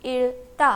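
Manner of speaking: इल्ता split as इल, then ता; pronunciation demo. Shown as a demonstration. Teacher shows how this word is pronounced, sounding out इल्ता by syllable. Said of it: "this word will be pronounced as".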